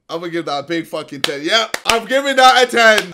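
loud, high excited shout of a rating; big hyped verdict, way up and loud. Real, not acted. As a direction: bellow it out like a fired-up fan.

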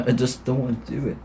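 low, very soft grumble; dejected, barely voiced protest; defeated reluctance. Real, not acted. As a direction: give up, quietly and low. Worn out, dreading another round.

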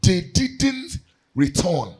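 fervent sermon, rising to shouts; preaching. Acted. Preacher urging the church toward gratitude, fervent. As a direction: preach it out to the congregation.

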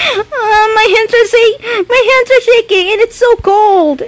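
real fright, voice jumped high and fast; gasping out panicked words while trembling; scared and shaky. Real, not acted. Player spooked in a horror game, high frightened babble. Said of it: "my hands are cold because I'm scared".

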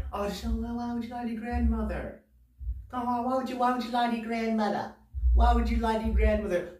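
high mock-scolding voice; reproachful listeners. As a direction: imitate scandalized listeners, pleading why. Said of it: "you guys are thinking is like".

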